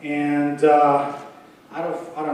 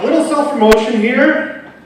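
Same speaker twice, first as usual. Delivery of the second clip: ordinary delivery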